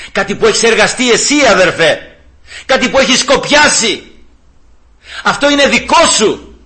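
high, emphatic insistence; impassioned preaching. Real, not acted. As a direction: drive home each short statement.

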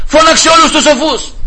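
high, urgent call to the servants; panicked royal command. Acted. Frightened king calling his servants to fetch the wise men, high and urgent.

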